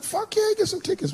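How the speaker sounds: high-pitched, keen retold reply; eager yes. Comedian replays telling an old friend he can get tickets, eagerly.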